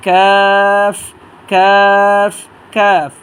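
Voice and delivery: কাফ with a long, loud, level vowel; back-of-tongue K. Shown as a demonstration. Teacher models a letter three times, loud and drawn out. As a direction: start কাফ just forward of the tongue root, then hold it. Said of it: "জিহ্বার গোড়া থেকে একটু সামনে".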